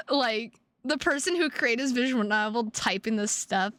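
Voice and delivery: ordinary talk, nothing marked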